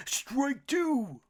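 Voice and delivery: loud, high umpire shout; umpire's call. A narrator's reading. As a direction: bellow the call across the field. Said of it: "The umpire called out".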